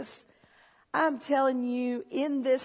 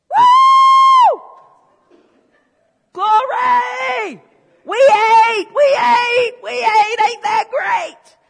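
shouted, much higher and louder praise with a crying edge; ecstatic shout; 12 semitones higher, 17 dB louder, much slower than usual. Real, not acted. Preacher to congregation, shouting, ecstatic praise. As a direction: erupt in joyful praise.

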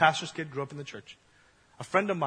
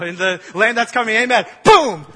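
big, louder shout of amen then boom; loud mock call; 4 semitones higher, 11 dB louder than usual. Moses loudly calls out an amen before the water flows. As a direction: shout it out to the crowd. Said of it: "You know".

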